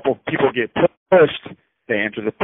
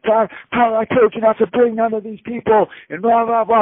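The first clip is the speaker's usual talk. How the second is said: much higher, scolding yell; mock yelling friend; 10 semitones higher than usual. Paulie yelling at Tyler for bringing these people. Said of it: "And he's screaming and yelling".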